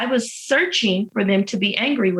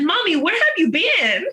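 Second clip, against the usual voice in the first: put-on high-pitched toddler calling Mommy; imitating little child; 9 semitones higher than usual. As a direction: play a small child greeting Mommy, voice lifted way up. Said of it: "when my baby walked in".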